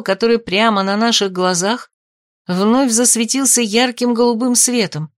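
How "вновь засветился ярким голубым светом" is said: usual speaking voice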